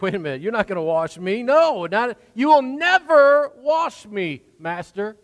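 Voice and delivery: Peter refusing, voice climbing high and loud; indignant protest. Retold or imitated. Peter refusing to let Jesus wash his feet, loud indignant protest. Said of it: "he gets indignant".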